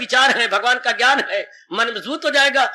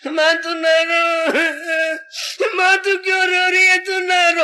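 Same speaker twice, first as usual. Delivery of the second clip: tearful plea; 5 semitones higher, much slower than usual. Retold or imitated. Child begging crying mother.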